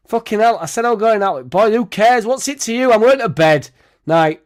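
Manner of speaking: put-on half-joking drunken shouting back at his partner; drunk indignant rant. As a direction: play the drunk bloke telling her who cares, loud and high. Acts his drunk self snapping.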